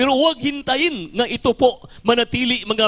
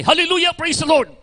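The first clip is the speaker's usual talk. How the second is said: shouted praise; 7 semitones higher than usual. Retold or imitated. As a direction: burst out like the mother facing the bandit. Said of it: "Pagsinggit ng nanay".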